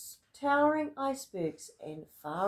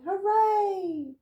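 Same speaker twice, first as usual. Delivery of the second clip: much higher, loud cheer; cheering shout; 10 semitones higher, 8 dB louder than usual. Acted. Villagers cheering as the whale swims away. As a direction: whoop with joy. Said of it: "villagers shouting".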